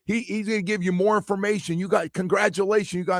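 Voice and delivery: high, loud hail across the street; calling out. Retold or imitated. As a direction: hype up the strangers on the bus. Calling out to tour bus.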